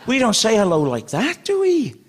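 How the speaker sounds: ordinary talk, nothing marked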